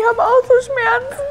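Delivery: crying out in pain; whimpering from the burning. Real, not acted. Burning pain from hot sauce.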